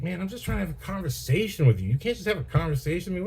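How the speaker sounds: high-pitched mock-exasperated whine; pissed off. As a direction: imitate an aggrieved guy pleading to be talked to. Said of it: "he's all pissed off".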